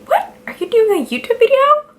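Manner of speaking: imitating her friend. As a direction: squeal it as the friend would. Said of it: "She should be like".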